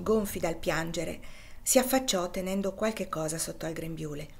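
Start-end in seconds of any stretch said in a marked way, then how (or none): none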